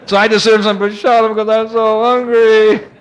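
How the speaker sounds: mock pleading. Pleading for food with the boys.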